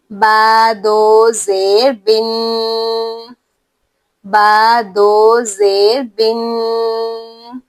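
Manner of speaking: بن drawn out very slowly through the nose; nasal ghunna. Shown as a demonstration. Teacher reciting ba with double zer, very slow and nasal. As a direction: linger on the nasal n. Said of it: "غنہ کے ساتھ".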